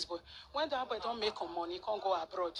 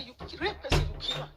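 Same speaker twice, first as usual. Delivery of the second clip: angry accusation; 3 semitones higher, 8 dB louder than usual. Retold or imitated. Retold accusation against the boy.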